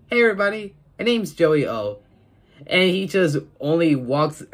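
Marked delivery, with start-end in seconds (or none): 0.1-2.0 youtuber greeting. Retold or imitated. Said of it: "it started to say".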